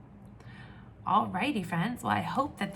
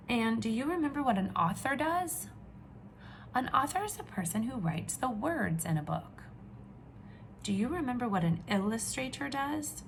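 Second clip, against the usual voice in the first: ordinary talk, nothing marked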